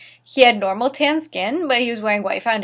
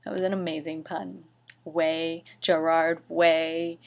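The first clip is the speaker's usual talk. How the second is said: deadpan sarcasm; 4 semitones lower, 7 dB quieter than usual. Real, not acted. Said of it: "deadpan sarcastic aside".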